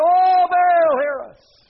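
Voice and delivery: loud, very high mock scream; screaming to a god. Prophets of Baal calling their silent god, acted scream. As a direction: wail it out at full voice, drawn out. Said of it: "screaming to their God".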